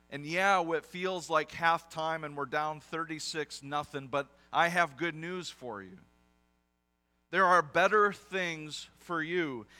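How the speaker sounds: loud, rising preacher's voice building to slow emphasis; preaching at full force. Acted. Preacher rallying a discouraged congregation, loud and building. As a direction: preach it out loud to lift them.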